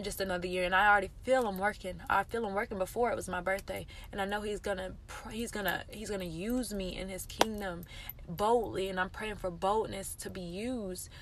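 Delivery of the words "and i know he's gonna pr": ordinary talk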